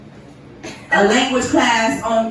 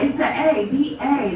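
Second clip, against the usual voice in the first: ordinary talk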